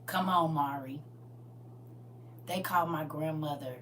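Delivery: ordinary delivery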